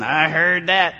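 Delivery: low East Tennessee drawl; East Tennessee accent. Acted. Somebody taught English in Romania by Tennesseans, East Tennessee accent. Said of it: "you hear somebody going".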